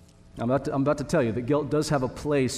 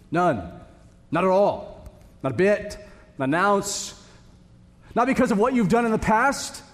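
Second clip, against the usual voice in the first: rising, louder emphatic build; 5 semitones higher, 4 dB louder than usual. Real, not acted. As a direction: pile up each denial, higher and louder. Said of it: "just notice how emphatic this is".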